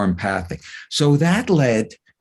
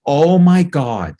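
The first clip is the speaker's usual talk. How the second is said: loud, drawn-out exclamation; imitated shout; 4 semitones higher, 5 dB louder, much slower than usual. Speaker retells a parent's outburst in the audience, shouted. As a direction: mimic a woman shouting out, stretching the words. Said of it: "just shouts it out".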